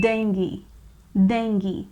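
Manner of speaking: dengue said as "DENG-ghee", ending in ee; correct dengue. Shown as a demonstration. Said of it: "we pronounce it as dengue".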